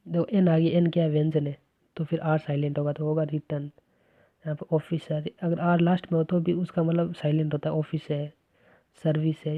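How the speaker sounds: ordinary delivery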